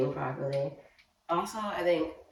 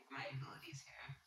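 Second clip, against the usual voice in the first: whisper; 16 dB quieter, faster than usual. Real, not acted. Whispering while working on dress.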